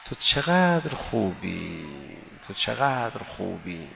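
very slow, soft, hushed تو چقدر خوبی; tender whisper-prayer. Acted. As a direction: murmur it lovingly to God. (زمزمهٔ عاشقانه و آرام)

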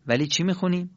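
usual speaking voice

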